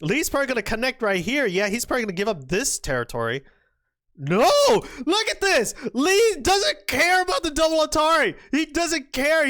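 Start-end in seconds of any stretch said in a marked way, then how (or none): 4.2-9.5 high, loud sports-fan excitement; thrilled, raised-voice commentary; amazed outburst. Real, not acted. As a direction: erupt in disbelief at the play. Commentator amazed by bold move.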